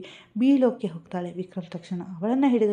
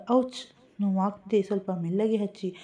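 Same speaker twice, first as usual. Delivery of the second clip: usual speaking voice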